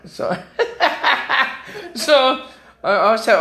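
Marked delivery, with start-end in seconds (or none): 0.4-1.9 high, breathy burst of laughter. Real, not acted. Husband laughing at his nurse story, high burst. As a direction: crack up at the story.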